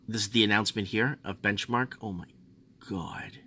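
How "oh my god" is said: ordinary talk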